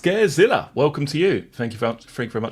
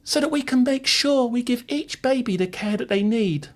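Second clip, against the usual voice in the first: very high, silly put-on voice; exaggerated high-pitched witness; mock alternative reading; 10 semitones higher than usual. Shown as a demonstration. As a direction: read it absurdly high to mock the complaint. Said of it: "would you prefer it if I, um, said it something like this".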